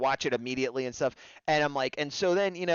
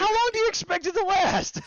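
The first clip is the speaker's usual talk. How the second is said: very high-pitched exclaimed question; high, strained comic indignation; mock outburst; 3 dB louder than usual. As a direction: squeal it as a playful protest. Joking comeback to a friend's tease.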